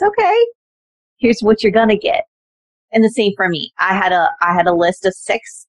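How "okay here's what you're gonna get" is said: Army voice. Retold or imitated. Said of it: "then the Army says".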